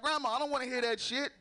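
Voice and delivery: high, pleading scold of grandma; mock exasperation at high grandma; exasperated scolding. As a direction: tell grandma to calm down. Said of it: "I'd be like".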